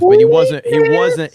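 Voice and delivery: high, loud emphatic outburst; excited. Acted. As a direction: push it up with excitement. Excited sports debate.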